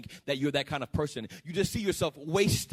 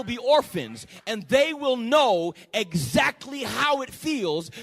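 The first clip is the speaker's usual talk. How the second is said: full-force preaching; 4 semitones higher, 6 dB louder than usual. Acted. Preacher voices God warning the afflicters, full-force preaching. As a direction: thunder out the warning.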